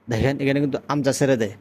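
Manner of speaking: usual speaking voice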